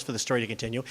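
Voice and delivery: plain reading voice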